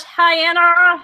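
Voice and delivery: high, drawn-out hello; excited greeting. Real, not acted. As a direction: greet an arriving friend, stretching it out. Greets a viewer who just arrived.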